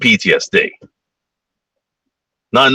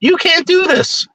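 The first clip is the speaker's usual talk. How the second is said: mock plea; slower than usual. Voicing captive soldier pleading.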